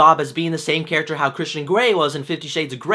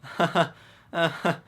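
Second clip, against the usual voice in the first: pity chuckles; 2 semitones lower, 5 dB quieter than usual. Retold or imitated. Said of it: "gave those chuckles where they feel bad".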